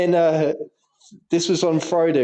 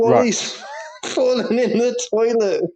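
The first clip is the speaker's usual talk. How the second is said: high laughing mock shout, words breaking into a laugh; retold shout; 9 semitones higher than usual. Teacher retelling a pupil's shout about Wally: laughing mock yell. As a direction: yell it like a kid, laughing through it. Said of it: "And he shouts out".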